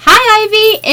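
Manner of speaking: cheery greeting. Retold or imitated. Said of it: "I'd be like".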